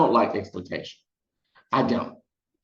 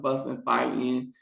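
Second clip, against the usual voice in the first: ordinary talk, nothing marked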